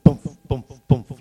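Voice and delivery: a thumping bump, bump, bump like a throbbing lump; imitated heartbeat. Shown as a demonstration. Showing the audience his throbbing neck, thumping bump sounds. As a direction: beat the words out like a pounding heart. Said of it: "like that".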